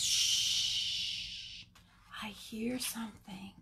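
long shush into a whisper; hushed whisper. Acted. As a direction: whisper as if listening hard. Penguin hushes, listening.

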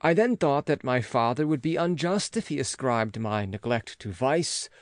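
plain reading voice

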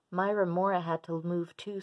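usual speaking voice

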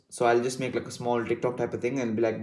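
normal talking voice

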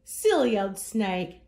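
ordinary delivery

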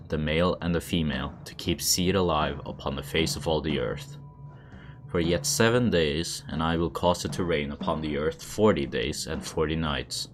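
usual speaking voice

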